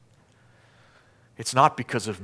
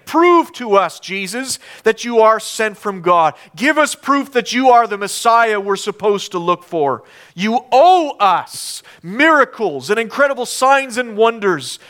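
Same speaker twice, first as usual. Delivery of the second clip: loud, higher, insistent challenge; demanding accusers; 7 semitones higher, 6 dB louder than usual. Acted. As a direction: demand proof, hitting the key words hard. Accusers demand proof from Jesus.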